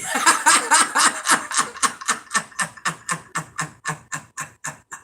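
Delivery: a big open burst of real laughter. Real, not acted. Laughing at own story.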